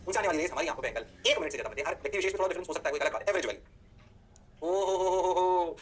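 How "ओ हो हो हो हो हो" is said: long, level string of ho-ho-ho; mock-amazed "oh-ho-ho". Teacher cheering on the class, mock-amazed. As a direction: stretch out the oh-ho-ho on one note.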